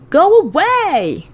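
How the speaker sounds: high, loud, drawn-out yell; scream. Acted. Girl screaming at her brother spying on her, scream. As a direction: scream it to chase him off. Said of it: "I scream".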